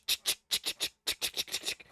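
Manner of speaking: soft unvoiced tick-tick of locks; whispered ticking locks. Shown as a demonstration. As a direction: mimic lock clicks in a breathy hush. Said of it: "you hear tick".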